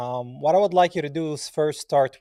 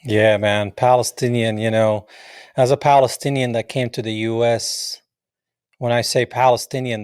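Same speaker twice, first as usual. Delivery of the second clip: louder; 7 dB louder than usual. Real, not acted. Host speaks with passion.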